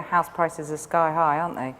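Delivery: normal talking voice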